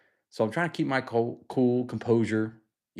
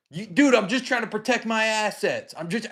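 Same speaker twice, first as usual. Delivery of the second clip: high defensive manager; 10 semitones higher than usual. Retold or imitated. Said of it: "he goes".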